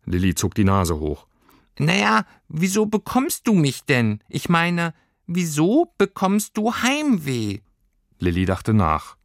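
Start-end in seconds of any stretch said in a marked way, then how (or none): 1.8-7.6 high, probing little creature voice; squeaky furry creature. A narrator's reading. Creature asks girl why she gets homesick, squeaky. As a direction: explain the question in a tiny piping voice.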